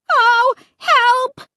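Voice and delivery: high, piercing cry for help; terrified shriek. A narrator's reading. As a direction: shriek in sheer panic. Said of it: "Was that me shrieking like that?".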